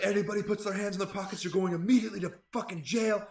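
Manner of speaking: loud, high, furious orders; retold enraged yelling; screaming. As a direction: imitate an officer screaming threats. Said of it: "she was, like, screaming".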